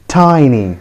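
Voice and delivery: "tiny" as TY-nee, falling; correct pronunciation. Shown as a demonstration. Teacher telling learners the correct form, "tiny" as TY-nee. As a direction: say "tiny" with an eye vowel. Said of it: "the correct pronunciation is".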